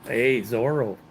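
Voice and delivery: ordinary talk, nothing marked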